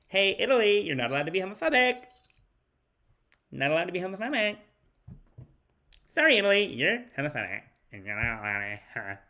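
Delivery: high, hurried scolding that tails off; Justin Trudeau. Acted. Comedian mimics leader lecturing Italy, mock scolding. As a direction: scold in a rushed mock voice, fading to mumbles. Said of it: "That's how he kinda sounds to me".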